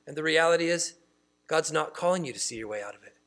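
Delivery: ordinary delivery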